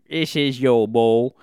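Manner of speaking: loud, drawn-out mock British accent. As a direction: hammer it out slowly in bad British. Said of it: "sort of a British accent".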